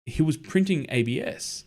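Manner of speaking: usual speaking voice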